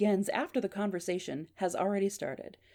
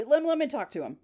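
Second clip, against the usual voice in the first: higher-pitched, insistent interjection; son in background; 8 semitones higher, 4 dB louder than usual. Acted. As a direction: call from behind to get the phone. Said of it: "Gary in the background".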